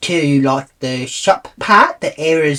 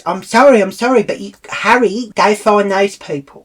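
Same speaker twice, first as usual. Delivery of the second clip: higher, louder imitated apology; flustered apology; 7 semitones higher, 5 dB louder than usual. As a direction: mimic an urgent apology, pitched up. Acts out ticket lady's hurried apology.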